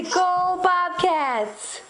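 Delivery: high and very slow, stressing "Go"; slow cheer for baby. Shown as a demonstration. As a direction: draw out "Go" for the baby.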